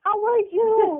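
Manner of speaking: put-on high, creepy little child; little kid voice. Shown as a demonstration. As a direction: pretend to be an odd little kid. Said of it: "Do your best little kid voice".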